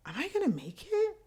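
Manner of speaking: very high, squeaky worried question; mock-small, high-pitched worry; fearful inner question. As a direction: voice a scared thought way up high. Voicing her fearful thought.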